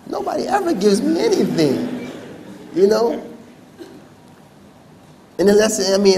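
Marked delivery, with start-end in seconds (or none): 0.1-2.1 high, loud comic wail; hammed-up weepy outburst; mock tearful. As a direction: wail it as if about to cry. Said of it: "I'm just ready to cry".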